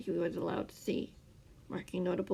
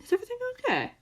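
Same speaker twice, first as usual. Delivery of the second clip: high, light teasing question; whimsical; much faster than usual. A narrator's reading. Scar teasing his grouchy captor, high and light. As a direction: ask it sweetly, mocking. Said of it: "Scar said whimsically".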